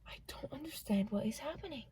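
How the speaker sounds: bewildered whisper; whisper, confused. Real, not acted. Whispering from truck nearby.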